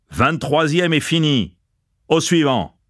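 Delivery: high-pitched serving-hatch announcement; announcing. A narrator's reading. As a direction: call the count out to the queue. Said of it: "il annonça".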